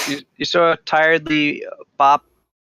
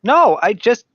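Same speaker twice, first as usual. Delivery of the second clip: higher, louder protest, slowing at the end; insistent character; 4 semitones higher, 4 dB louder, slower than usual. Acted. Game master answers as Pascal being compelled, insistent.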